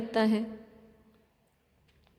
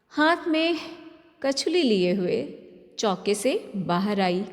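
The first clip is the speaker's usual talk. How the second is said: ordinary delivery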